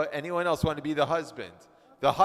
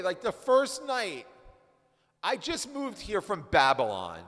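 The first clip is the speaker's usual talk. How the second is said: put-on exasperated Babylonian husband; 7 semitones higher than usual. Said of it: "let's read it here".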